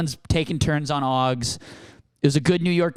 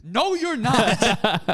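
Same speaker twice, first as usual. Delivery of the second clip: high, stretched-out mock refusal; insistent cop; 7 semitones higher, much slower than usual. Cops answering the giddy mom, mock insistent. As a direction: shut them down, hammed up.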